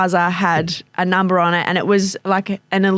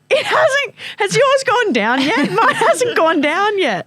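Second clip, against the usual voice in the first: very high, slow wail of complaint; hammed-up whine breaking into laughter; mock dismay; 14 semitones higher, slower than usual. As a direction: imitate an impatient friend, pitched way up. Acting out friend waiting on buzzer.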